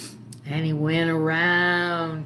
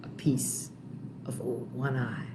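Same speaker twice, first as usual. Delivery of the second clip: hushed; 3 semitones lower, 7 dB quieter than usual. A narrator's reading. Storyteller hushes the gory bit.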